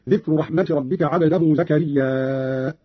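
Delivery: "زكريا" drawn out long, closed with a glottal stop; slow recitation, stretch the last a of "زكريا" into a hamza; long connected madd on "زكريا"; hamza after alif. Shown as a demonstration. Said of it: "قرأ قالون بالهمز بعد الألف، فيصير عنده مدا متصلا".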